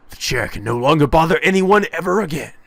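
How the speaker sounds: rising, strained mad gloating; maniacal triumph. Acted. Acted mad triumph over chair.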